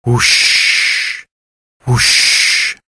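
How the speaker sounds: breathy "whoosh" like surf; drawn-out whooshing wave noise; crashing waves. Acted. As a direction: imitate waves rolling in, unvoiced hush. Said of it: "sound of crashing waves".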